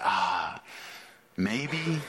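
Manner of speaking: soft, unsure murmur; hesitant doubt. Acted. Believer asked does he delight in you, hesitant and soft. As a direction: trail in quietly, not convinced.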